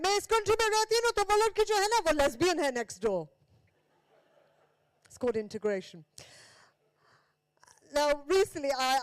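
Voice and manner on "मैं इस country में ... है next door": high, loud, rapid-fire Urdu-English rant; imitated Urdu-English speaker. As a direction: rattle it off high and loud, mixing languages. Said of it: "she started to talk Urdu".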